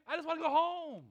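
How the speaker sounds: high, loud mock wail; exasperated inner cry. Waiter facing late customers before closing, exasperated inner cry. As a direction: let it burst out, fed up. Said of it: "you know what you're thinking".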